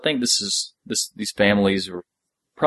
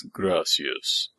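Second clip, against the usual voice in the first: low, soft imitation of a big man; imitating bass voice; 4 semitones lower, 7 dB quieter than usual. Podcaster quotes big Fredo from the show, in a low bass. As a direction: drop it deep and quiet like a huge guy. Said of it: "the bass in the dude's voice".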